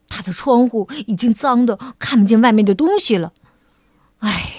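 low-voiced rabbit, mildly angry; 越想越生气. A narrator's reading. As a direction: grumble to yourself with a touch of anger. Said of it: "兔子先生越想越生气". (低声抱怨，略带怒气)

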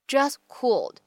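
"just cooled" joined, final T of "just" dropped; lost plosion. Shown as a demonstration. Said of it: "我们可以读成是just cooled".

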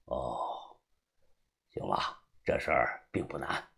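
put-on very low, quiet old man's voice; old boss. Old man asking his underling about progress, low and quiet. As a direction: speak low and soft as an elder in charge.

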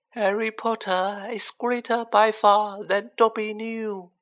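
high, slow, tearful elf voice; drawn-out high elf praise, overcome; sobbing elf. A narrator's reading. Dobby hugging Harry, sobbing his praise. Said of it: "he sobbed".

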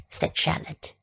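ordinary delivery, nothing marked

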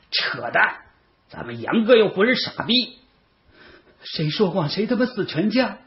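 high-pitched indignant retort; heated arguing. A narrator's reading. Boys quarrelling over who hit first, indignant. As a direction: argue back high and heated.

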